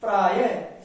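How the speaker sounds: प्रायः ending in "he"; visarga h sound. Shown as a demonstration. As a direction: say प्रायः as "praa-ya-he". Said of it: "उच्चारण क्या हो जाएगा".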